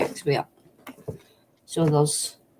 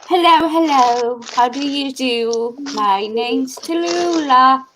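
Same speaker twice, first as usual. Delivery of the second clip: higher, slow put-on English voice; slow childhood fake English greeting, pitched up; fake English accent; 7 semitones higher, slower than usual. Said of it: "we used to put on fake English voices".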